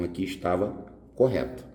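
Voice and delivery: ordinary talk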